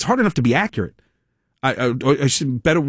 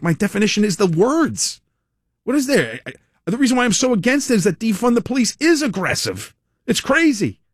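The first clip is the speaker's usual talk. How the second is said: indignant; 8 semitones higher than usual. Retold or imitated. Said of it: "I'm like".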